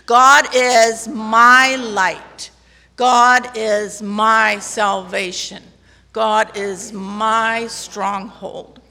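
slow, deliberate declaration, every word weighed; slow proclamation. Shown as a demonstration. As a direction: proclaim it slowly for the congregation to repeat. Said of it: "you have to say it for yourself".

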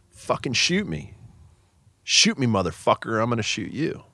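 half-acted scream, slowed on the first words; retold scream. Officer retelling the armed man's threat, a mock yell. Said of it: "And he's screaming".

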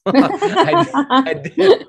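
high burst of laughter through the words; laughing. Real, not acted. Guest laughing at his schoolboy knack for talking out of trouble.

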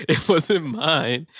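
slow, drawn-out mock denial. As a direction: drag it out, mocking. Mocking the roommate's denial.

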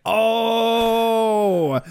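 long drawn-out high "oh"; drawn-out collective "ohh"; knowing "ohh". Acted. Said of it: "we're all like".

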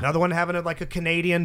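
ordinary talk

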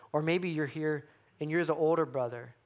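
ordinary talk, nothing marked

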